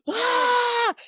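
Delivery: high, loud squeal of joy; excited scream. Retold or imitated. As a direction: shriek with delight at the news. Screaming at bestseller news.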